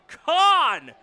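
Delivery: loud, high mock yell from the dugout; imitating a player yelling out; imitated yell. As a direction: holler it like an annoyed batter, drawn out. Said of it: "yelling out".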